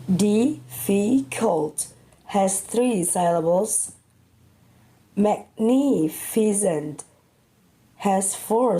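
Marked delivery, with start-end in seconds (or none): 0.1-1.9 Difficult drawn out slowly, syllable by syllable; slow syllable count. Shown as a demonstration. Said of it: "count how many syllables". 5.2-7.0 Magnificent said slowly, syllable by syllable; slow syllable count. Shown as a demonstration. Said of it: "count how many syllables".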